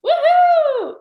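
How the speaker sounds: very high, loud joyful whoop. Real, not acted. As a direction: cheer at the top of your lungs. Cheer after the chant.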